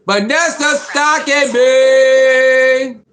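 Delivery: loud, slow, drawn-out teasing call; mock complaint. Teasing Vanessa on the livestream for following him, loud and stretched. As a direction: stretch it out like a playful accusation.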